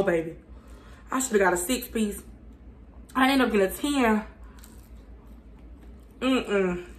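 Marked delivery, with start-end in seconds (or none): none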